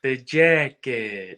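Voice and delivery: slow, drawn-out menacing line; Richie quote. Retold or imitated. Quoting Richie to a fan, slow and menacing. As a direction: lean on it like a threat. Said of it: "quoting Richie line".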